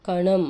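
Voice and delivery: కణం said loud with a nasal ణ; nasal letter demo. Shown as a demonstration. Teacher showing kids the nasal letter. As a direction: sound the ణం of కణం through the nose. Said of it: "ముక్కుతో పలుకుతుంది".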